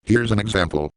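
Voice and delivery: usual speaking voice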